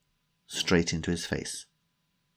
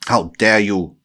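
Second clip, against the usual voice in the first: put-on loud, Russian-accented hiss; angry hiss; 10 dB louder than usual. A narrator's reading. Tanya rebukes Timothy for mentioning her companion, furious hiss. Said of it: "she hissed".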